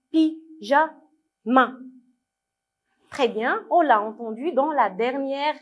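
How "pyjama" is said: "Pyjama" very slowly, as py, ja, ma; three separate beats in "Pyjama", clear gaps between; syllable by syllable. Shown as a demonstration. Teacher asking where ma is heard, "Pyjama" slowly split by syllable. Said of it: "on a entendu la syllabe ma".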